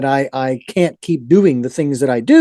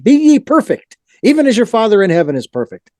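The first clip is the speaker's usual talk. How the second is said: scripture recital; 5 semitones higher than usual. Retold or imitated. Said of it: "I remember hearing the passage".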